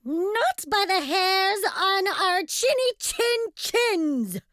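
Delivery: high, slow, steady refusal; defiant piggy shout. A narrator's reading. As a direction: call it out high and unwavering. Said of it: "shouted Percy".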